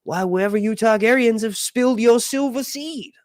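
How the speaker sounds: high put-on character voice; Mushroom. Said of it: "And, and Mushroom says".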